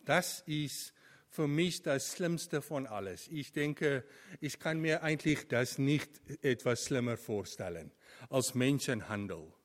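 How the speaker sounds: ordinary talk, nothing marked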